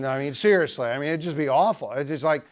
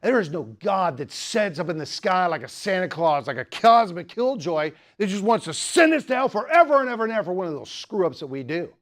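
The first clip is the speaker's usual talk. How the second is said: imitated angry rant; 2 semitones higher, 3 dB louder than usual. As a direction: play an atheist let off the leash, building steadily. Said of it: "like sick him to a dog".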